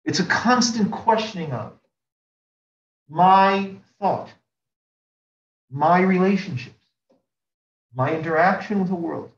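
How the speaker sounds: usual speaking voice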